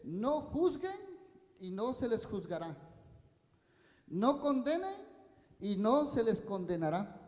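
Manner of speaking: loud, ringing pulpit preaching; fervent sermon delivery; full-force preaching. Acted. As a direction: proclaim each verse to the whole room. Preaching with Bible reading.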